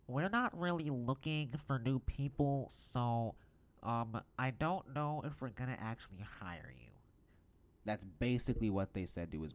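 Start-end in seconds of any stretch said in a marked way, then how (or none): 0.1-6.9 high mock employee voice; pitched-up imitation of an unhelpful employee; put-on employee voice. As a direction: mimic a store employee brushing someone off. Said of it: "let me put on the voice".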